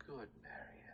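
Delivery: soft unvoiced whisper; menacing whisper. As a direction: purr it with threat.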